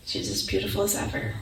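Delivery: low, soft, near-whispered; tender hush. Retold or imitated. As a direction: say it gently, almost breathing it. Answering husband about daughter.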